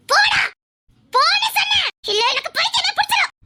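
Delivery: high, aggressive screaming; furious shouting. Acted. Girl ordering a man to leave, furious. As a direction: scream him away in a rage. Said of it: "ஆக்ரோஷமா கத்திக்கிட்டு இருக்கா".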